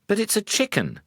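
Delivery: high, insistent protest; protesting wife. Acted. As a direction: stand your ground as the wife. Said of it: "she protested".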